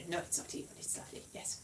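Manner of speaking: whispered aside; hushed little take-back. Acted. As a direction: breathe it as a secret correction. Hushed correction of her own joke.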